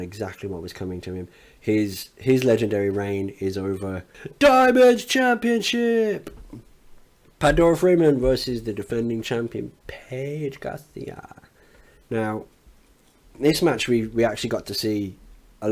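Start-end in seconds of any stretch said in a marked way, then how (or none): 4.4-11.4 high, slow, drawn-out ring announcer call. Acted. Recapping the show, announcing the title match like a ring announcer. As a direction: stretch it out like announcing a title bout.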